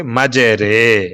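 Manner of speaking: "مجرے" slowly as maj-RAY; long ay after the r in "مجرے"; majhool vowel. Shown as a demonstration. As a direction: say "مجرے" to rhyme with qatray. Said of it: "اسی طرح اس را کو پڑھیں گے".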